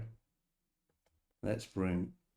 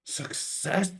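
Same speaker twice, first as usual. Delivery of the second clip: triumphant; 12 semitones higher, 4 dB louder than usual. Real, not acted. AI avatar finally works.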